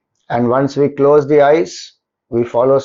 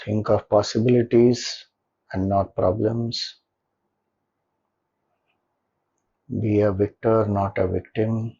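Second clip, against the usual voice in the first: soft, lower meditation voice with long pauses; guided meditation; 4 semitones lower, 6 dB quieter than usual. Acted. Leader settling listeners into a comfortable posture and gratitude, guided meditation. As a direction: guide them gently into stillness, leaving long silences between phrases.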